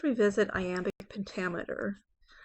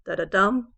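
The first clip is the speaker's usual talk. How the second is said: "da-da-dum" with two light beats and a louder "dum"; metrical foot demo; 7 dB louder than usual. Shown as a demonstration. Teacher showing another foot, final stress on "da-da-dum". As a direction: land hard on the "dum" of "da-da-dum". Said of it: "we have different types of feet".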